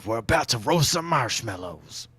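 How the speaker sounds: folksy drifter growl. Acted. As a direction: invite them in like a wandering rogue. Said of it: "My Drifter voice".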